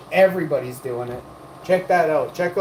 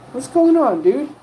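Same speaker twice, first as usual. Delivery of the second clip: excited greeting; 10 semitones higher, 5 dB louder than usual. Real, not acted. Greeting a chatter on stream.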